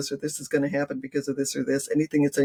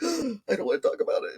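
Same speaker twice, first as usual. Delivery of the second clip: reluctant relative; 8 semitones higher than usual. Retold or imitated. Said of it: "Some people are like".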